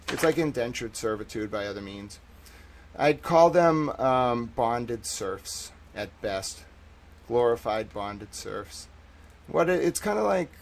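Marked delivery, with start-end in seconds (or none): 4.0-8.9 1 slow deliberate. Real, not acted. Slowly settling on a phrase.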